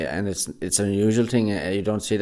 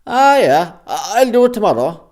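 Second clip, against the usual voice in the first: lazy local character; 14 semitones higher, 14 dB louder than usual. Retold or imitated. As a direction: play the carefree fella brushing off the job. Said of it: "He will say".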